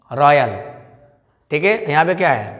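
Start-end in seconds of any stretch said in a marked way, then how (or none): 0.1-0.6 रॉयल said "RAA-yal", low and falling; lower-pitched model "raa-yal" for रॉयल; corrected pronunciation. Shown as a demonstration. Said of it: "लेकिन रॉयल नहीं".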